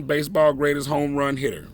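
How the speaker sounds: ordinary delivery, nothing marked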